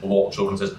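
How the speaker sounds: ordinary talk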